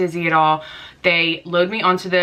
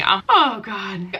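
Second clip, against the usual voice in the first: ordinary talk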